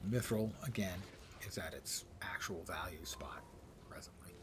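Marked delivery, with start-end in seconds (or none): 1.5-4.3 whispering. Real, not acted. Whispered aside.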